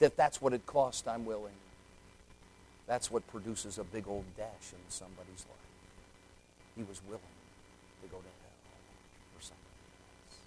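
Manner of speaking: from spoken plea down to very soft; fading to a hush. Acted. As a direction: let the surrender trail away.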